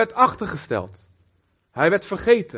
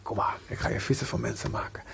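soft breathy whisper, lower; whispered invitation; 5 semitones lower, 6 dB quieter than usual. Acted. Jesus inviting Peter to follow him, whispered.

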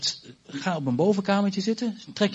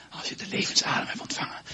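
whispered; 4 dB quieter than usual. Real, not acted. Speaking of the breath of life.

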